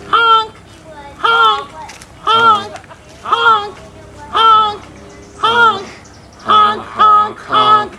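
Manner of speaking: loud goofy honk calls; honking like a tuba. Shown as a demonstration. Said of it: "this is the tune".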